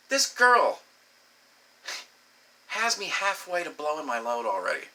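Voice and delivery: ordinary talk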